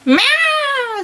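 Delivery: high, far-off cat miaow; cat meow. Acted. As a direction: purr it out like a cat. Character's line in read-aloud fanfic.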